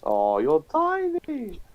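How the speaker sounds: high, slow teasing coo. Real, not acted. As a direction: stretch out tiny, playfully mocking. Teasing friend about height.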